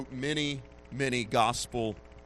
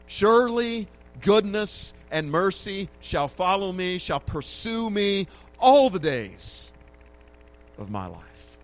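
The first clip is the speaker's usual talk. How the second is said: higher and louder, slowing into the last line; climactic scripture reading; 7 semitones higher, 6 dB louder than usual. Acted. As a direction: build to the psalm's climax, then fall away. Climax of the psalm.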